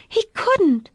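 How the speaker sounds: put-on high, distressed little-girl voice; tearful child's protest. A narrator's reading. Daughter defending her friend, tearful and high. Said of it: "tears swimming in her eyes".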